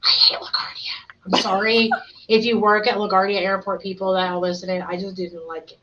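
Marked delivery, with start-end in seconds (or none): 0.0-1.3 slow, whispered admission; hushed, drawn-out whisper; whispered confession. Real, not acted. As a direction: confess it under your breath. Confessing airport dislike, repeated low.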